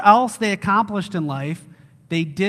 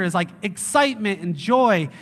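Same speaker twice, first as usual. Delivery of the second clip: normal talking voice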